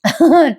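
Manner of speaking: short high laugh; quick real giggle; amused giggle. Real, not acted. निवेदिका स्वतः हसते. (छोटं, उंच हसू)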